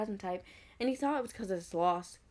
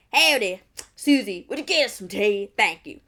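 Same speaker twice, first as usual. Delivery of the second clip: put-on southern accent; 11 dB louder than usual. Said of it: "with a rather strong southern accent".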